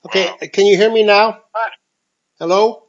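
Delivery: tinny speakerphone sound; talking through a phone speaker. Real, not acted. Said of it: "so I'm calling on my speakerphone".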